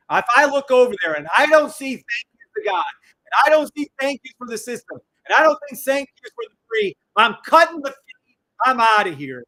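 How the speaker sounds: loud, indignant threat to walk out; heated, emphatic warning; worked-up ultimatum. Real, not acted. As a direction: lay down the law at full voice. Threatens to quit over missing thanks.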